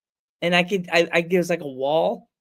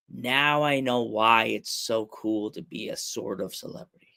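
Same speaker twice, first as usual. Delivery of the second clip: low, drawn-out musing; mock self-importance; 6 semitones lower, 3 dB quieter than usual. Host joking about his sort-of fame, mock smug. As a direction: muse grandly about your own small fame.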